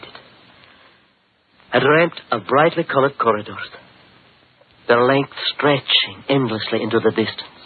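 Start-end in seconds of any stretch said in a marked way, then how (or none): 1.7-7.6 hushed, wondering recollection over music; dreamy storytelling. Acted. Count recounts his strange dream to Holmes, dreamy and awed. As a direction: tell the dream as if still caught in it.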